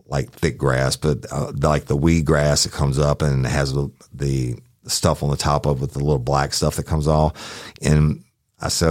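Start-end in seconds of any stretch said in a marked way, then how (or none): none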